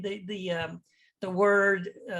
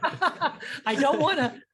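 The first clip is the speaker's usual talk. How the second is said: laughing through a quote; 4 semitones higher than usual. Retold or imitated. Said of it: "And I'm like".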